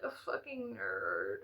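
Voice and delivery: soft, slow muttered admission. Acted. Admitting to viewers she is a nerd, muttered softly. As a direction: mumble it to yourself. Self-deprecating admission, then repeated loudly.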